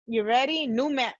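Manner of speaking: usual speaking voice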